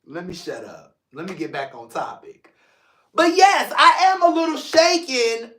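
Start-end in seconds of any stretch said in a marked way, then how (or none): 0.0-2.5 quiet self-correction. Real, not acted. Catches himself, reins it in.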